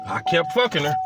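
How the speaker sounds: normal talking voice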